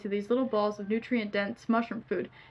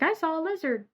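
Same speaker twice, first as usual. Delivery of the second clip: put-on high, childlike little voice; excited kid voice; cute little boy; 7 semitones higher than usual. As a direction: play him small and wide-eyed. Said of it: "I just like to play him 'cause he's cute".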